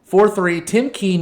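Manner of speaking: exasperated outburst. Real, not acted. Team concedes again.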